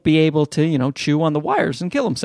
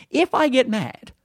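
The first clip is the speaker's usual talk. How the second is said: ordinary talk, nothing marked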